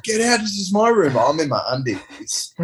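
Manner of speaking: high-pitched indignant mock yell, easing at the end; acted-out outraged protest; retold arguing. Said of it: "we're arguing".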